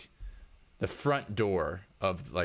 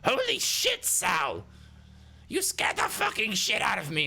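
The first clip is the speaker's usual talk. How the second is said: very high, strained startled character voice; startled outburst; 13 semitones higher than usual. Acted. Boss just scared by his employee, high startled outburst. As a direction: yelp it, still jolted by the scare. Startled man scolds her.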